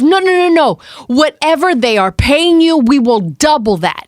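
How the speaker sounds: imitating him, high and urgent no no no; mock panic, rushing to outbid; frantic pleading. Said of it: "He goes".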